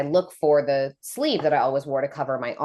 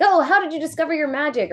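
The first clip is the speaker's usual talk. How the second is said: much higher, mock-curious asking voice; curious asker; 12 semitones higher, faster than usual. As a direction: imitate eager people asking. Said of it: "People usually ask".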